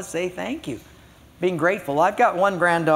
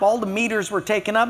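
ordinary talk, nothing marked

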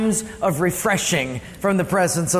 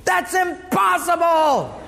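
incredulous shout; 9 semitones higher, slower than usual. Retold or imitated. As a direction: shout it in disbelief. Time traveler refuses to believe.

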